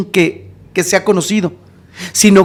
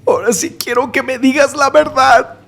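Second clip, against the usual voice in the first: imitated tearful plea; 5 semitones higher than usual. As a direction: act out a man starting to cry. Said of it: "Y empieza a llorar".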